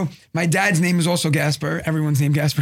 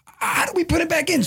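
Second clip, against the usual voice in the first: exasperated panic; 10 semitones higher than usual. Retold or imitated. Retells panicked reply to dad.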